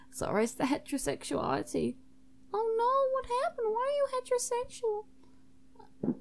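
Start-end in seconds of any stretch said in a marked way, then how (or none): 2.5-6.1 very high, fast put-on voice; concerned student host. Zara Hall hearing of the visitor's curse, high concerned voice. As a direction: react in a high squeak of concern.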